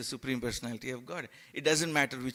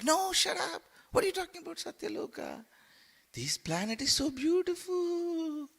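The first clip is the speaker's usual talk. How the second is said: much higher, mocking temptress voice; scolding Maya. Maya mocking the soul's wish for Satyaloka, high scoffing squeal.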